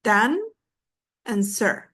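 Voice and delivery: "dan" and "sir" each said as its own beat; syllables separated. Shown as a demonstration. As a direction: count out "dan", pause, then "sir". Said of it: "two syllables".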